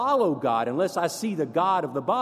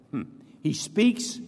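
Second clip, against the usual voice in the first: ordinary talk, nothing marked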